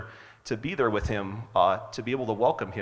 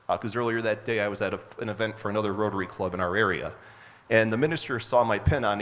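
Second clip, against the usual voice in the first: ordinary delivery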